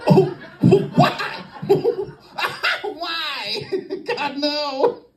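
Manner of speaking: high, strangled squeak of agony; mock pained squeal. Comic reliving a grab to his groin, high pained squeal. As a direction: squeak it out weakly, as if hit below the belt. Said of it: "takes a second for the boys".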